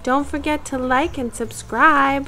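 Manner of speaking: high bright voice for little ones; sweet sign-off to kids. Acted. Host says goodbye to young listeners, high sweet sign-off. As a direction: wave goodbye to the children.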